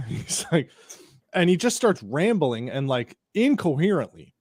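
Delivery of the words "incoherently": ordinary talk, nothing marked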